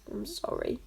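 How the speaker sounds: quiet croaked apology. Acted. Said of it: "croaked Varjak".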